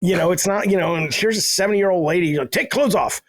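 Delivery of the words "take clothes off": put-on higher, broken-English Japanese accent order; mock the old masseuse's Japanese accent, pitched up; imitated Japanese accent. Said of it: "I'm doing the accent".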